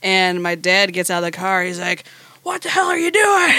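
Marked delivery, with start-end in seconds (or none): 2.4-3.5 high yelling father, half laughing; retold angry shout of a father; angry dad shout. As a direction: bark it like a furious dad. Dad catches kids in woods.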